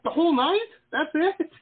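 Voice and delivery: high, loud incredulous squeak; mock disbelief. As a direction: act shocked at such tiny drinking. Mock disbelief at two drinks.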